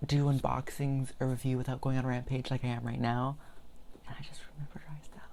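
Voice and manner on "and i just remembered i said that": breathy fast whispered aside; whispering. Real, not acted. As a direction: whisper it quickly to yourself. Aside remark to herself.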